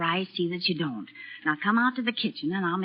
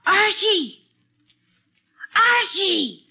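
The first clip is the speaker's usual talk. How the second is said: high, raised call for her son; 8 semitones higher, 3 dB louder, much slower than usual. Acted. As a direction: call him from another room, louder each time. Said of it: "I'll call him".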